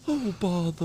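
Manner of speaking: soft slow Pooh voice; Winnie the Pooh. Pretend. Friends trading Pooh lines, soft. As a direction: sigh it like Pooh. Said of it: "Winnie the Pooh".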